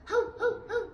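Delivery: high, loud repeated woofs; dog barking. Shown as a demonstration. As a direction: imitate a dog in short barks. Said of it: "like dog".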